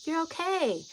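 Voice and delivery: high, tender comforting tone; speaking love. Retold or imitated. Guide comforting a scared friend, high loving tone. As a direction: comfort them as you would a small child. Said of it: "I'm speaking in this tone".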